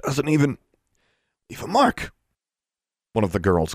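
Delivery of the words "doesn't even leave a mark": high, halting words between breaths; pained, breath-broken. A narrator's reading. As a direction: force it out through pain. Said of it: "between painful breaths".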